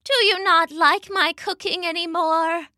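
high, hurt Mama voice; sad. A narrator's reading. Mama doubts Papa likes her cooking, sad. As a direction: ask it sadly, pitched well up. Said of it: "Mama asked sadly".

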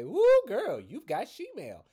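loud, high, sassy queen voice; sassy drag queen. Retold or imitated. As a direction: shout it out like a drag host. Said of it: "Like RuPaul's Drag Race used to say".

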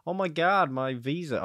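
put-on accidental, high-pitched New York accent; mock New York exclamation. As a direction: slip into a hammed-up New Yorker. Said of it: "I'm stuck in New York accent now".

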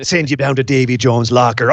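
hearty pirate threat, lightly voiced. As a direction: growl it like a pirate captain. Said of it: "your pirate voice".

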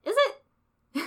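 high, loud clueless "Is it?"; mock surprise. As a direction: play dumb, pitch way up. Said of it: "he's like".

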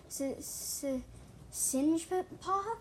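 drawn-out stutter, rising high on Singepaw; hesitant repeat. A narrator's reading. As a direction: stammer twice, then stretch the questioning name. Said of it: "repeated, quieter".

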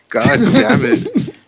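drawn-out, high mock curse through laughter; laughing exasperation. Man retelling his stall ordeal to friends, laughing exasperation. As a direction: stretch it out, laughing at your own misery.

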